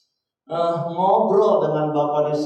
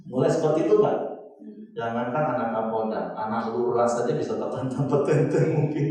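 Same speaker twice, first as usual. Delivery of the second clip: ordinary talk, nothing marked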